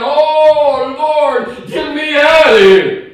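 loud high desperate cry to God; panicked plea. Retold or imitated. Terrified man in a bar brawl crying out to God. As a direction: beg to be got out, rising panic.

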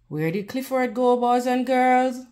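ordinary delivery, nothing marked

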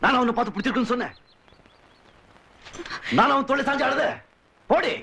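high, heated rhetorical questions; angry accusation. Acted. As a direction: throw the accusation back, pitch climbing. Hurt accusation in a quarrel. (கோபமாகக் குற்றம் சாட்டும் உயர்ந்த குரல்)

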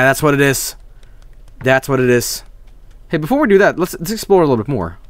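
normal talking voice

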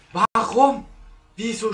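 loud, high why; frustrated outcry. Real, not acted. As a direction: cry out in frustration. Frustrated outcry after death.

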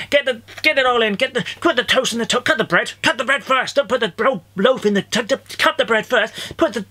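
high-pitched, panicky race against the kettle; frantic. Acted. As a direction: rattle off orders in a flustered rush.

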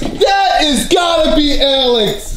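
slow, stretched-out exclamation; insistent shout. Real, not acted. Sure it is Alex making the noise, slow insistent shout. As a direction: shout it slowly with full conviction.

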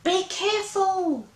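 high small monster voices calling; cried after her. Acted. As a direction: call worriedly after a friend. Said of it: "they all cried after her".

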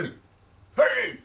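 high, loud re-enacted shout; retold yelling. Man yelling at the neighbour's dog heading for the mailman, re-enacted shout. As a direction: holler as if calling off a dog. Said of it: "I yell at the dog".

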